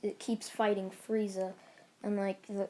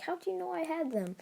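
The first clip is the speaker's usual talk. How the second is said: high, quick startled question; 8 semitones higher, 3 dB quieter, faster than usual. Retold or imitated. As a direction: ask it in mock surprise, pitched up. Acted startled question in a story.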